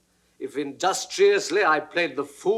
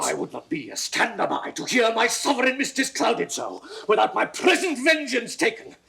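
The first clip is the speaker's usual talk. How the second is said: high, raised voice of outrage; indignant outburst; 8 semitones higher than usual. Acted. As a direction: rebuke your king with rising heat. Camillo defends the queen.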